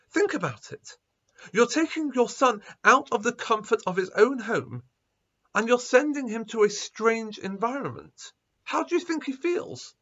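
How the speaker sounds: agitated rabbi pleading for the son; agitated rising plea. A narrator's reading. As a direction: press them harder with each line, then a sharp high question. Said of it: "became quite agitated".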